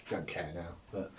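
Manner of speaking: usual speaking voice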